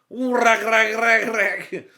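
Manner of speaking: high, loud chant of rag; helicopter noise. Retold or imitated. Suspect acting out the helicopter, loud and high. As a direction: chant it like spinning blades.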